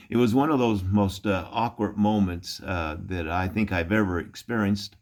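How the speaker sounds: normal talking voice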